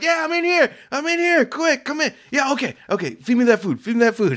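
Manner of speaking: very high, urgent mock pleading voices; calling out eagerly. Workers lined up at the gates for food, mock-desperate calling. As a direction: imitate hungry captives begging through bars.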